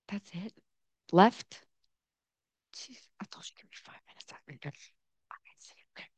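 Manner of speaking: a quick off-mic whisper; whispered aside. Real, not acted. Whispering to the timekeeper about the five minutes. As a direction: hiss it aside to the person timing her.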